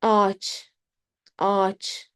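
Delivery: ağaç as one long a, no g; silent ğ. Shown as a demonstration. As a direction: stretch the a in ağaç over the silent ğ. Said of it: "We don't say ağaç".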